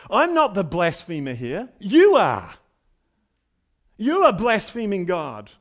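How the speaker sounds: loud accusing preacher voice; Jesus turning the tables on his accusers; confronting accusers. Acted. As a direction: turn the accusation back on them. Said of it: "flipping the tables".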